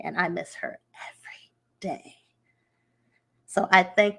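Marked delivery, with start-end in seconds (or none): 0.0-2.2 tearful whisper. Real, not acted. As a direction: whisper it, voice almost gone with emotion. Emotional tribute to spiritual mother.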